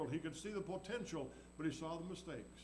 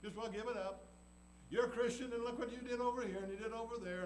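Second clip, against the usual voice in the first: higher, sneering taunt; devil taunting; 7 semitones higher than usual. Acted. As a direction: tease and accuse like the devil. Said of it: "The devil will say that".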